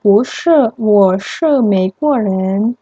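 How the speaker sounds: Ana's answer, slow and careful; a lightly voiced Ana, slowed for learners; slow dialogue line. Shown as a demonstration. Teacher reading Ana's answer to students, slow. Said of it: "Ana menjawab".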